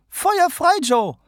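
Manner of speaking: hoch und laut anfeuern; anfeuernd. A narrator's reading. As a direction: treibt Joe lachend an. O'Connor drängt Joe. (O'Connor feuert Joe laut an)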